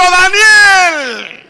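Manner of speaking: loud, high, tearful shout of the name; crying out at the top of his voice. Retold or imitated. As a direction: yell the name, voice breaking. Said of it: "a decirle a voz en cuello". (el rey grita a todo pulmón, con llanto)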